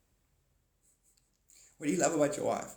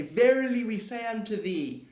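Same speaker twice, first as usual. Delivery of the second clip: higher, louder archaic "Verily we say unto thee"; alien proclamation; 8 semitones higher, 6 dB louder than usual. Retold or imitated. Aliens greeting the humans, grand, higher and louder. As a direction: intone like a grand alien herald. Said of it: "they say".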